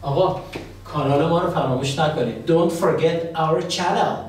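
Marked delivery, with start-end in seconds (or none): 2.5-4.1 slow, drawn-out reminder; teacher's slow sign-off plug; slow channel reminder. Shown as a demonstration. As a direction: stretch each word for the viewers. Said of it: "channel plug".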